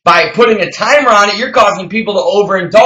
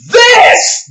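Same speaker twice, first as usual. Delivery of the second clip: one very high, drawn-out cry; emphatic shout; 4 dB louder than usual. Real, not acted. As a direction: belt it out high as the big answer. Impassioned rant naming the answer.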